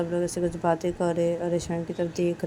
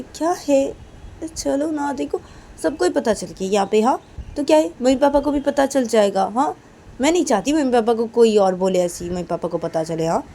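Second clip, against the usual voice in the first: loud, higher insistence; insistent Kajal; 7 semitones higher, 10 dB louder than usual. Acted. Kajal insisting to Reshma, loud and high. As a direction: push her to tell the parents now.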